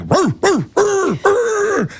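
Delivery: high yelping bark, hammed up; mock alarm barks to wake the sleepers; dog barking. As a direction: bark like a frantic guard dog. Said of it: "like a dog barking".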